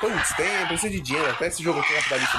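ordinary talk

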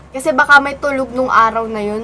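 ordinary talk, nothing marked

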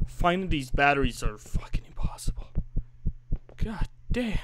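frustrated under-breath whisper. Real, not acted. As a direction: grumble it almost voiceless. Frustrated search muttered.

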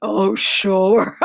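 monster's acted guffaw; scoff, then break into a laugh; hammed-up laughing Gruffalo; bursting with laughter. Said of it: "said the Gruffalo, bursting with laughter".

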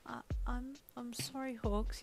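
soft, high, hesitant sadness; small sorrowful voice, stumbling into the apology; sad apology. A narrator's reading. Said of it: "you said sadly".

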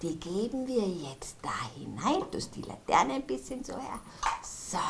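soft whisper. Acted. Kasperl whispers to little Lara while lighting the candle. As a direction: whisper to calm the child. Quietly fitting candle for child.

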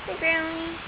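normal talking voice

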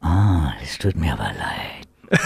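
low unvoiced mocking whisper; dark lord. Shown as a demonstration. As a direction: whisper a fake apology cruelly. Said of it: "wie klingt der Psycho, wenn [name] den Psycho macht".

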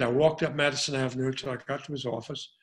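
ordinary talk

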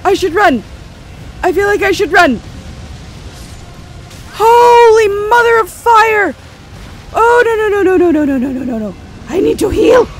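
high frantic shouting; panicked yelling. Real, not acted. Fleeing a dragon nobody warned about, frantic yelling. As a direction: yell in real panic.

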